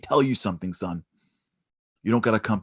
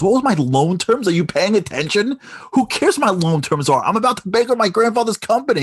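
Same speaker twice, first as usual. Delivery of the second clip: higher and louder, fed up; exasperated yell; 11 semitones higher, 8 dB louder than usual. Retold or imitated. Son yells at the stranger asking about loan terms, exasperated. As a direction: shout it in frustration. Son angrily snapping back.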